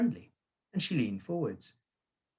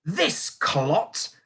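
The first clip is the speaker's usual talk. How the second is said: higher, booming contempt; booming insult; 7 semitones higher, 4 dB louder than usual. A narrator's reading. Headmistress insulting boy before school, booming. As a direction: boom it at the whole hall. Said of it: "boomed the headmistress".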